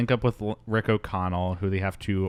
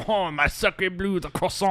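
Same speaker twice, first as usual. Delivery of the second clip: high, slow hammed-up French accent; mock French accent, drawn out high; 10 semitones higher than usual. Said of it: "a French guy in a movie".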